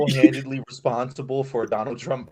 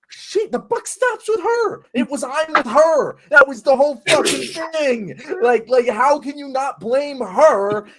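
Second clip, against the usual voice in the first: exasperated rising rant; heated rant; 9 semitones higher, 4 dB louder than usual. Real, not acted. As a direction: rant pitched high and loud, words slowed. Ranting about Hillary's loss.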